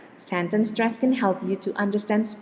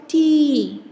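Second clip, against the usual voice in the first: T said higher, isolated as a demo; stressed syllable alone; 7 semitones higher, 4 dB louder than usual. Shown as a demonstration. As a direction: lift the syllable T up high. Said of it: "It should be higher also".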